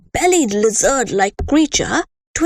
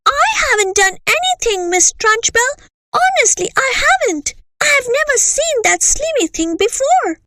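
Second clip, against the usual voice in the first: put-on much higher, fast protesting girl; pleading child. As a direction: beg to be believed.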